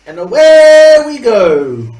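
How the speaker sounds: loud, drawn-out send-off; calling out. Pretend. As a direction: stretch it out in a big call. Calling out as they set off.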